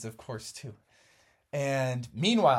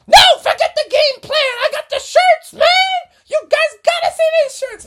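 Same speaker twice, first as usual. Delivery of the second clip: high, squeaky cheerful mom voice; giddy team mom; 15 dB louder than usual. Acted. Missus Frogerson cheering the boys on, high and giddy.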